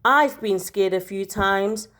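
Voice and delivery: ordinary delivery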